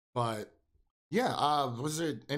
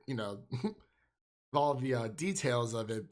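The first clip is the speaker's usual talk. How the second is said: ordinary talk, nothing marked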